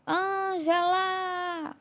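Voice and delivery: slow, drawn-out high call from far away; distant calling voice. Retold or imitated. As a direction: stretch the name like a voice calling from far off. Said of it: "uma voz longe lá no fim dizia". (voz distante chamando, arrastada)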